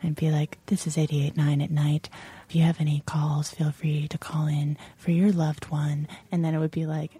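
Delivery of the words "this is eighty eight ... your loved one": low, smooth R&B radio host; late-night DJ voice. Retold or imitated. Former host opens the request lines, late-night DJ voice. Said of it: "a late night R and B show".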